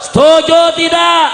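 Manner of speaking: high, booming demand for an answer; rallying shout. Real, not acted. Preacher demands agreement on taxes, rallying shout. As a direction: shout it to rouse the whole crowd. Preacher repeats louder to rouse crowd.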